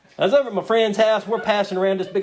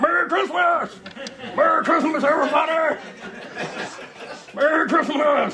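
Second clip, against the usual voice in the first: cheery shout; 8 semitones higher than usual. Retold or imitated. Wired after nine sleepless days, shouting Merry Christmas to the family. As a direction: bellow it like a manic party host. Sleepless, bursting into mother's house.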